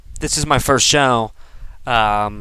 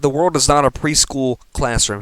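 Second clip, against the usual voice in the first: ordinary talk, nothing marked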